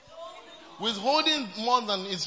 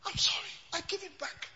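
meek apology; 6 semitones higher, 9 dB quieter than usual. Pretend. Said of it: "When the church said".

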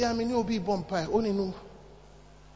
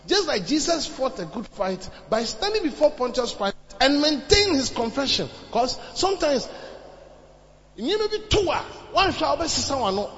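loud, raised-pitch sermon delivery; impassioned preaching; 6 semitones higher, 5 dB louder than usual. Acted. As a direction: preach it forcefully to the congregation. Preaches at full force.

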